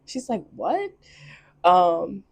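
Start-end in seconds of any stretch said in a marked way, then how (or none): 0.5-0.9 shocked. Retold or imitated. Eva shocked seeing him alive.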